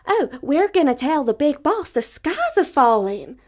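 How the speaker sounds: high, loud, breathless hen; alarmed little hen. Acted. Little hen telling the rooster the sky falls, high and loud. Birds announce the falling sky.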